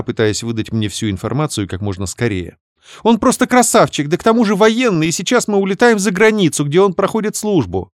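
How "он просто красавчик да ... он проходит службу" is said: rattling off. Acted. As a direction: rattle it off excitedly. Sister gushing. (затараторила, высоко)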